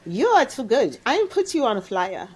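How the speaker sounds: a light mock German accent, upbeat and insistent. Roommate Begonia booking her for a party, lightly mock German accent.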